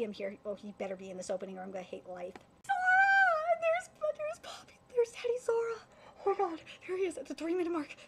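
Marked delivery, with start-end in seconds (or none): 2.7-8.0 very high squeal, loud at the start, easing slightly; ecstatic shriek. Real, not acted. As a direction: shriek the name, then keep squealing in delight. Fan spots favourite characters on screen.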